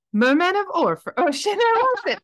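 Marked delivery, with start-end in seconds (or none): 0.1-1.1 loud, high put-on British accent; hammed-up amateur British accent. Shown as a demonstration. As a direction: announce it in an exaggerated British accent. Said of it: "do that accent for me".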